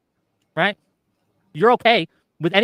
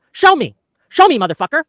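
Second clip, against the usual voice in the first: loud furious demand, voice up high; angry shout; 7 semitones higher, 6 dB louder than usual. Real, not acted. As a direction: bark it at him, fed up. Angry demand in heated argument.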